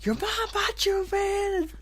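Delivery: mom yelling back. Retold or imitated. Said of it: "you hear your mom yell back".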